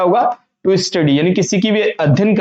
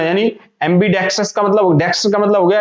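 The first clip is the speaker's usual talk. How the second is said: normal talking voice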